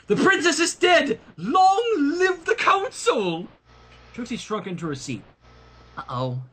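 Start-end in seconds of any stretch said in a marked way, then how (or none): 0.1-3.5 high shouted proclamation, slowing at the end; mayor on the throne steps crying out to the crowd; proclaiming shout. Acted. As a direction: declare it to the whole hall.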